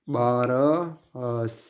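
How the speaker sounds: very slow, stretched syllables; drawn out. Shown as a demonstration. Teacher spelling out the answer for students, drawn out.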